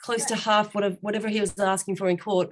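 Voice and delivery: ordinary delivery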